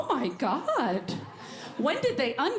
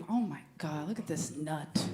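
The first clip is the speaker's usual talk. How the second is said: low, much softer mutter under the breath; muttered aside; 10 semitones lower, 7 dB quieter than usual. Retold or imitated. Teen muttering about her dad, low and soft. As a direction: say it under your breath, lower. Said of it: "I'm like".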